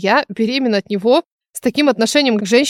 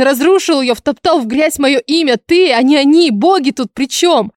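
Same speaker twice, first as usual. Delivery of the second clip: screaming; 4 semitones higher than usual. Acted. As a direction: yell it out, hurt and furious. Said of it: "я сорвалась на крик". (срывается на крик)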